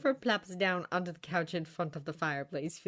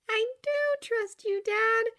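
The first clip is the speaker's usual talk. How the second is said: high, slow, unconvinced daughter; doubtful daughter; 4 dB louder, slower than usual. Acted. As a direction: say it slowly and high, lacking confidence.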